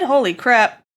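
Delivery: usual speaking voice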